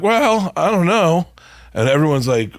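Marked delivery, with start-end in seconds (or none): 0.0-1.3 put-on high, hesitant girly voice; mock young girls. Young girls at sushi judging bone marrow, high hesitant voice. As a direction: play it like unsure young girls. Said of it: "they're like".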